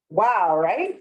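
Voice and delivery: normal talking voice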